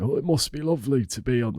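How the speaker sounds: soft put-on posh city accent; airy posh city accent, wistful. Mocking city folk, a soft posh city accent. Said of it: "Why am I doing that accent?".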